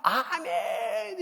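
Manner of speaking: long drawn-out high, loud amen; an old pastor crying out amen on the phone; ecstatic cry. Retold or imitated. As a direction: cry out amen in overwhelming joy. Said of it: "이러고".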